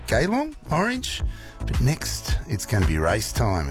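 ordinary talk, nothing marked

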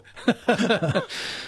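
a burst of high laughter. Real, not acted. Host laughing at the school nurse memory.

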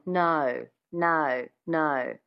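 "No" with a schwa slipped in before the O, Australian twang; Aussie "No, no, no", vowel pulled back. Shown as a demonstration. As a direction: repeat "No" in a flat Australian accent. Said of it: "You can hear it now".

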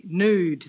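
"nude" said as nood; yod-dropped nude. Shown as a demonstration. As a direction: lose the y-glide in "nude". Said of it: "Most people would say".

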